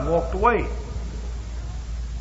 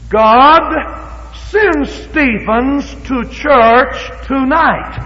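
very slow, raised, each word spaced; slow pointed prayer; 8 semitones higher, 4 dB louder, much slower than usual. Shown as a demonstration. Preacher showing the congregation a pinpointed prayer. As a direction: pray it out word by word. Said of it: "this is what we're gonna pray".

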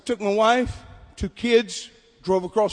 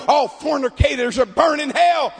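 high, raised hellfire preacher shout; fiery preaching; 5 semitones higher, 6 dB louder than usual. Retold or imitated. Guest preacher warning fornicators through an interpreter, fiery preaching. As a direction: imitate a visiting preacher thundering a warning. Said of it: "he's up there preaching".

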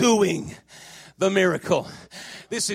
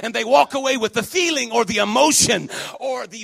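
forceful preaching, building in volume and pitch; volle prediking; 3 semitones higher, 6 dB louder than usual. Acted. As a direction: drive each phrase home to the congregation.

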